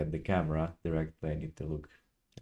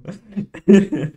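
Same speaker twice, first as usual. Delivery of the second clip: very loud, high real laugh; burst of laughter; 13 semitones higher, 13 dB louder than usual. Real, not acted. Viewer reacting to a silly word list, bursting out laughing.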